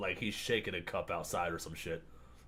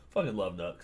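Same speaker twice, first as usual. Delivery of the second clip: normal talking voice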